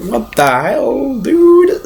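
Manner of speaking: mock-appalled, rising sharply on "dude"; hammed-up high disbelief; shocked disbelief. Acts own shocked reaction.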